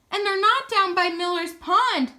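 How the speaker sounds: ordinary delivery, nothing marked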